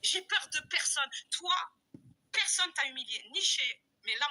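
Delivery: usual speaking voice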